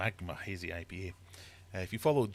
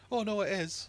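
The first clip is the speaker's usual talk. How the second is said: mock insistent host; 13 semitones higher, 3 dB louder than usual. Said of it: "and I'm going".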